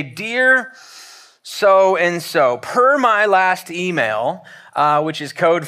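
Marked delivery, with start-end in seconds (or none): 0.0-4.4 drawn-out, pointed "per my last email"; mock passive-aggressive. Pastor mocking a snippy email, slow and pointed. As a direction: lean into the snark, slowly.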